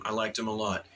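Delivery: normal talking voice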